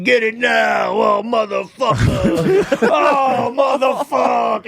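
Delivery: hammed-up complaining patient; mock yelling. Angry patient yelling at the nurses, drawn-out mock yell. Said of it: "he's, like, yelling the whole time".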